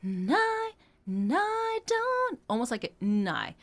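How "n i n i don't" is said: "N I" sung with "and" reduced to a bare n; and as just n. Shown as a demonstration. Coach showing the swallowed and. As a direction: turn "N" into just an n sound. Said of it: "It's almost like just an n".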